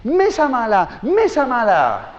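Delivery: put-on high, slow, singsong baby-talk scolding; mother scolding table. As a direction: tell off the naughty table for the toddler. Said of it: "y empieza". (madre regañando a la mesa, tono agudo, lento y aniñado)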